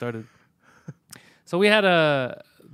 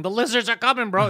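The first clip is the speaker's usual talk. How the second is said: high, loud mock warning; yelling crank; 9 semitones higher, 8 dB louder than usual. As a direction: yell it like a street prophet. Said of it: "The guy yelling".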